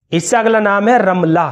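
ordinary delivery

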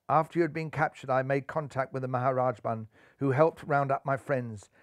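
smiling. A narrator's reading. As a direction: report the news softly with a smile. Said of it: "smiled Ellie".

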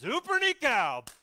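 God's slow, high, loud proclamation; booming God. Acted. God declares victory over the Egyptians, booming and slow. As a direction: thunder it out as God slamming the sea. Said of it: "And God says".

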